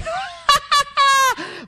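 high, hammed-up peal of laughter; crowd laughter. A narrator's reading. The people laughing in disbelief, high performed laugh. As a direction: burst out in high mocking laughter. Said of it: "e tutti ridevano".

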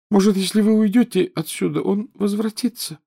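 high, reproachful schoolgirl ghost; whiny ghost girl. A narrator's reading. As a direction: sulk like a neglected ghost girl. Narrator voices Myrtle, hopeful.

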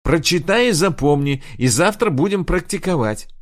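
loud, high irritated command; angry order. Acted. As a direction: bark the order, still furious. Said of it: "разозлилась Инг". (сердитый приказ)

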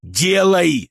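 barked order. A narrator's reading. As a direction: bark it out. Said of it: "рявкнул я".

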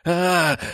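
high startled yelp; short waking cry. A narrator's reading. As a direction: jolt awake with a cry. Scream waking. (испуганный вскрик)

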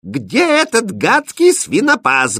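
high, drawn-out irate princess; imperious, high-pitched indignation; angry demand. A narrator's reading. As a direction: demand it wrathfully, stretching the words. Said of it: "гневно спросила принцесса".

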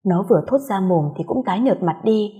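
ordinary delivery, nothing marked

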